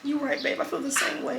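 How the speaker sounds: high, soft imitation of a wife; Amani. Husband mimics wife's calm reply to his gushing, soft high imitation. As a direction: mimic her understated agreement in a small high voice. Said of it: "Amani can just be like".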